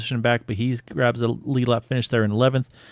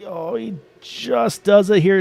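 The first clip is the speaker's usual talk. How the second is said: excited; 9 semitones higher, 4 dB louder, slower than usual. Real, not acted. Driver pulls off the slide job.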